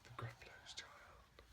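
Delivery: hushed whisper. A narrator's reading. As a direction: breathe the title softly. Whispered title at bedtime.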